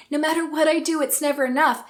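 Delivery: normal talking voice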